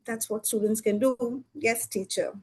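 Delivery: soft, dropped, deflated reply; very sadly. Acted. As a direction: mumble it low like a scolded kid. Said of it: "Very sadly".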